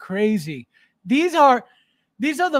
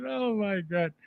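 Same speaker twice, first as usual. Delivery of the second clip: ordinary talk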